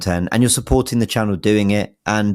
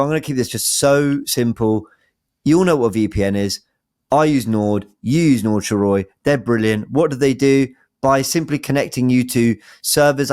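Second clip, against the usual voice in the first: normal talking voice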